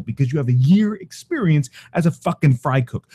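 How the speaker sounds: ordinary talk, nothing marked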